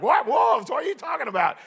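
city folk caught off guard; startled. Retold or imitated. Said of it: "we're city people".

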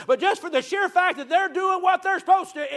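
impassioned high-pitched preaching; emphatic preaching. Real, not acted. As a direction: drive the point home with a soaring voice. Preacher drives his point home.

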